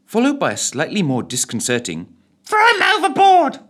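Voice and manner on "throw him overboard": very high, loud parrot screech; shrieking parrot voice. A narrator's reading. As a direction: screech it like a hideous bird. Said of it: "sounded like a screeching animal".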